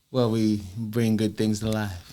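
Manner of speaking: low soft radio DJ voice; smooth late night host; late night slow jam. Pretend. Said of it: "late night slow jam".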